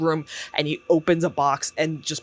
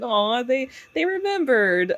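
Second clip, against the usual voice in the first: mock touched; 8 semitones higher than usual. Touched hero sees birthday cake.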